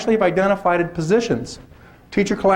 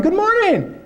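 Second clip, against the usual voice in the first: much higher, gushing first-day cheer; over-the-top teacher welcome; 9 semitones higher than usual. Retold or imitated. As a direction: greet the class like a sugary morning host. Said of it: "don't listen to that first twenty minutes".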